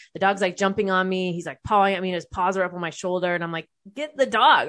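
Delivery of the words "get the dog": higher-pitched urgent call, re-enacted; urgent plea. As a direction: plead for someone to grab the dog. Acted alarm, dog jumping on them.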